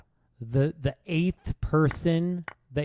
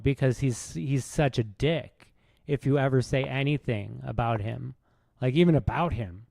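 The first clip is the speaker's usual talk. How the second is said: ordinary talk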